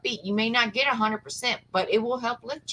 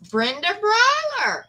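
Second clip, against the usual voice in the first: high, slow and louder, drawing out the winner's name; excited announcement; 12 semitones higher, 5 dB louder, slower than usual. Real, not acted. Host announcing the grand prize winner to her viewers, high and drawn out. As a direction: stretch the name out like a big reveal.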